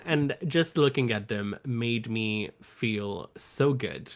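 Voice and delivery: usual speaking voice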